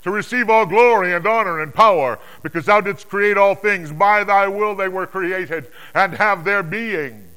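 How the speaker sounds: high, loud, ringing proclamation; slow, exalted acclamation at full volume; crying out praise. Acted. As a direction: cry it out in reverent worship, far above narration. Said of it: "they cry".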